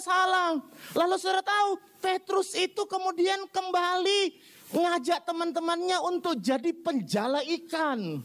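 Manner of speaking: high-pitched, insistent sermon delivery; impassioned preaching. Real, not acted. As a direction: drive the point home from the pulpit. (khotbah berapi-api)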